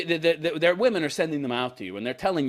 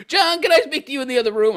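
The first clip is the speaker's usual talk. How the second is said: urgent, higher-pitched summons to a private word; quick, raised voice of an alarmed family member; worried relative; 11 semitones higher, 5 dB louder, faster than usual. Acted. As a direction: call the spouse aside, fast and high. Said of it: "Somebody in her family would have said".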